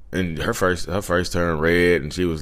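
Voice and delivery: ordinary delivery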